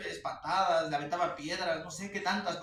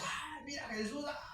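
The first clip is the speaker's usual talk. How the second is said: mock-pious gasp; 10 semitones higher, 7 dB quieter than usual. Mocking imagined reverent crowd.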